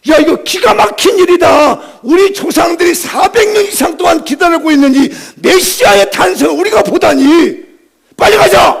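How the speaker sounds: amazed, urgent shepherds. Acted. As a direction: burst out in awe, then urge everyone to go.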